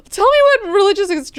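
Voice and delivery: pushier mock Leto. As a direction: rush the demand, ramping it up.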